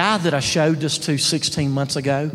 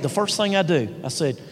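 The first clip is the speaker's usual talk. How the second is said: normal talking voice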